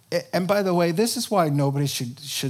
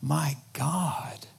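prayerful whisper; 5 dB quieter, much slower than usual. Retold or imitated. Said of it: "There wasn't".

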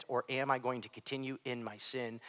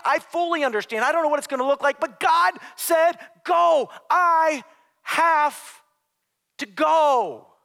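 high, loud preaching climax, slowing at the end; shouted resolve; 14 semitones higher, 13 dB louder than usual. Acted. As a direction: declare it at full force, hammering the last words. Acted Paul's climactic resolve.

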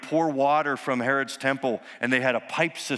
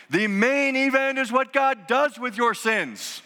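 full-force preaching; 10 semitones higher than usual. Acted. Pastor to congregation, preaching at full force. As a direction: preach it out to the congregation with rising fire.

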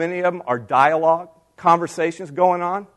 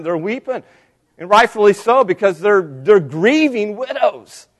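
normal talking voice

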